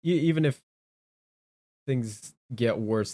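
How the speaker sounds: ordinary delivery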